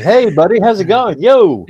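loud, high, overly pally bro greeting; imitating a too-casual bro; mock bro attitude. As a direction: ham up a fake-buddy hello. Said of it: "kind of attitude".

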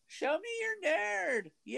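very high, slow, stretched-out cheer; crowd-style cheering. Pretend. Co-host hyping the nerd store, crowd-style cheering. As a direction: holler it in falsetto like a fan.

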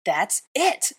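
declaring. A narrator's reading. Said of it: "I declared".

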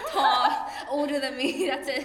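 loud, giggly listing; laughing. Real, not acted. Girl admitting her simple dating type to a friend, loud laughter. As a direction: laugh between each word.

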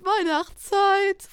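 high, loud, slow echo; drawn-out loud call; stretched jingle. Shown as a demonstration. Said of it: "noch ein Anlauf".

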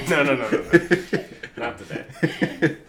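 laughing. Real, not acted. Laughing off the sound effect.

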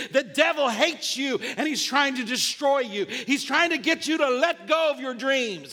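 full-force, driving sermon delivery; impassioned preaching. Real, not acted. Preacher urging people to keep hoping in God, impassioned preaching. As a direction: preach it hard, phrase after phrase.

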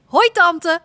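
put-on a girl calling hello to her aunt; bright hello called across the room. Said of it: "roept Lulu".

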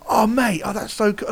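high-pitched, gushing retold reaction; animated. Drummer chatting to a young fan after the show, animated. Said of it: "he got really animated".

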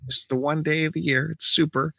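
high, drawn-out customer protest; insistent correction. Retold or imitated. Customer correcting cashier on super points day, insistent.

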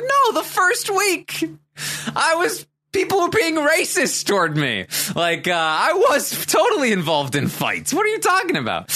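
imitating an outraged denial, very high-pitched; indignant protest. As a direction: squawk back in mock outrage. Acted indignant denial in argument.

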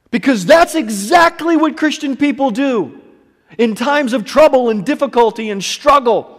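high, loud impassioned preaching; fiery sermon climax; preaching at full force. Acted. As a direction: rouse the congregation to stand.

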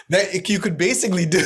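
laughing burst, half shouted. Real, not acted. Delivering a joke to the host with a laughing burst. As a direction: laugh out the punchline.

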